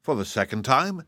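keenly curious question, lightly played; great interest. A narrator's reading. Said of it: "asked the wizard with great interest".

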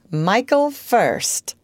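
slow, stubborn insistence; dogged. A narrator's reading. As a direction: dig in your heels, slowly. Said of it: "he said doggedly".

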